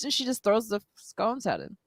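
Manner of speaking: usual speaking voice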